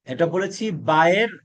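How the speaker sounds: ordinary talk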